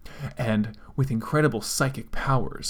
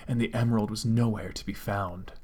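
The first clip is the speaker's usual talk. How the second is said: plain reading voice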